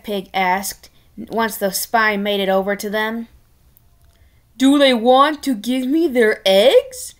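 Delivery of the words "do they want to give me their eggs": louder, greedy pig king. Acted. King asking spy about eggs, greedy demand.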